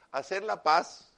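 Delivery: "Hacer la paz" said slow and loud, every word weighted; slow, loud, weighty "Hacer la paz"; emphatic phrase. Real, not acted. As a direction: drag out "Hacer la paz" to drive the point home. Preacher hammers the key phrase.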